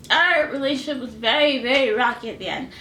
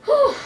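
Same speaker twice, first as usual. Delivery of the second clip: sharp high exhale; 9 dB louder than usual. Real, not acted. Composing herself after tears.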